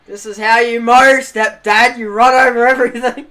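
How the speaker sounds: loud laughing taunt. Retold or imitated. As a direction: gloat through laughter at stepdad. Taunting stepdad while laughing.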